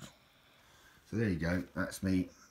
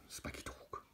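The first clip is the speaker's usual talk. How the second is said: whispered Spiky dog, softer; 14 dB quieter than usual. Shown as a demonstration. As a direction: breathe the name out under his breath. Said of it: "my old Spiky dog".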